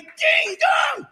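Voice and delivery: usual speaking voice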